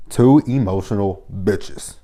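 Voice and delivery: drawn-out, every word spaced; slow emphatic repeat. Real, not acted. Vlogger repeating a verdict on two quarrelling men, slow and emphatic. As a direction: say it slowly, letting each word land.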